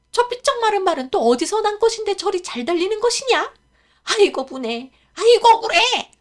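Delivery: wailing 아이고 분해 in frustration; shocked fury. Acted. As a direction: rant in disbelief and spite. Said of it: "크게 놀라 화를 냈습니다".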